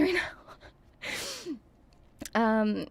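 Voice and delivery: ordinary delivery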